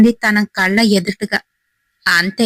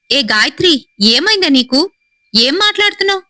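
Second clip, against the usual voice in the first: higher-pitched, startled questioning; alarmed questioning; 6 semitones higher than usual. Acted. Husband woken by wife's strange talk, alarmed questioning. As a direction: ask in rising alarm, pitch climbing.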